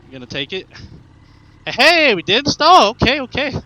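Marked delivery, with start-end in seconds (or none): 1.6-3.5 loud, thrilled cheer; excited shout. Real, not acted. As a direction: yell out with relief. Relief at not stalling bike.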